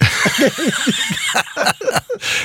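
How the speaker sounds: high breathy burst of laughter; hearty laugh. Real, not acted. Laughing at own elevator joke.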